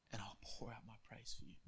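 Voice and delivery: whispered prayer, unvoiced and soft. Job whispering his praise to God.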